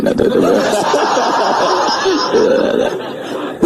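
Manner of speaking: long high laughter; real laughter up high. Real, not acted. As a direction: laugh helplessly at the punchline. Laughing at own story.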